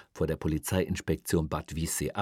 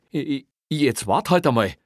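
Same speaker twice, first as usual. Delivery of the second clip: high, loud, stammered plea to wait; flustered protest; 8 semitones higher, 9 dB louder than usual. Acted. Officer tries to stop his boss walking off, flustered. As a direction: stumble on the first word, then burst out.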